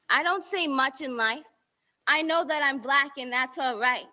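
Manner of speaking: ordinary talk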